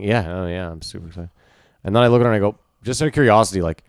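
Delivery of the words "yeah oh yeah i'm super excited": low, half-hearted show of excitement; feigned excitement. Retold or imitated. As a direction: fake being excited, quietly and without any lift. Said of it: "I'm fainting".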